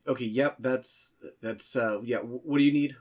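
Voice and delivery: high, loud, flustered stammer; harried voice. Acted. The stressed intern fumbles on the phone to Claymore, harried. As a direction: scramble for words, overwhelmed. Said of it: "a very harried voice".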